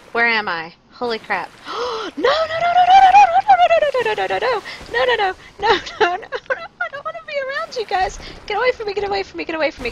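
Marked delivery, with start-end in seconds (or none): 1.6-2.1 sharp gasp. Real, not acted. Sudden danger in game. 2.2-6.3 shrill, much higher panicked no's, loud; real terror, yell no over and over; screaming no in a high voice as enemies swarm. Real, not acted. I'm probably yelling and grunting. 6.8-9.9 much higher, fast, frantic pleading; real high-pitched panic; panicked squeal. Real, not acted. Fleeing enemies in panic.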